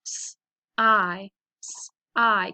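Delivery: "sigh" as s, gap, long i; segmented phonics "sigh"; sounded out. Shown as a demonstration. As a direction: sound out "sigh" twice with gaps. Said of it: "makes that long I".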